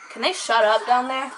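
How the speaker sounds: loud, annoyed snap at the noise downstairs; irritated outburst. Real, not acted. Vlogger yelling at noisy kids downstairs, annoyed. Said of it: "Sorry, I'm getting angry".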